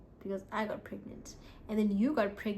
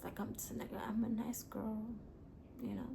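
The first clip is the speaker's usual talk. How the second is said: whisper; 10 dB quieter than usual. Real, not acted. Coy self-description.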